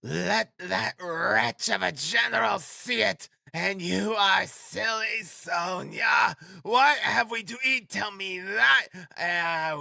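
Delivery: very high, stretched-out frantic cries; crying out. A narrator's reading. Frantic widow railing at Sonia, then hailing Rodion, crying out. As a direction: cry out wildly, dragging the words. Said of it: "she cried".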